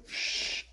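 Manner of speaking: shush. Retold or imitated. Sokka shushing at the play.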